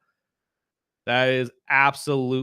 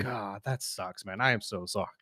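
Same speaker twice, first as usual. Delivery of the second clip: soft, sorry for him; low-key sympathy for a friend; commiserating; 5 semitones lower, 8 dB quieter than usual. Real, not acted. Friend's car got hit.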